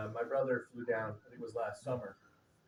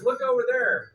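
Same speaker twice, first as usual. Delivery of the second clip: excited pointing; 11 semitones higher, 13 dB louder than usual. Retold or imitated. Cousin excitedly pointing out lights.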